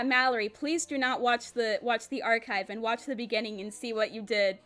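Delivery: ordinary delivery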